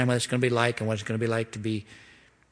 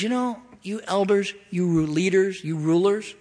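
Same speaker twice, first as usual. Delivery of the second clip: higher, indignant scolding voice; God rebuking the leaders; 6 semitones higher than usual. Pretend. A touch of God rebuking the rulers, higher. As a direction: call the elders out. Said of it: "He goes".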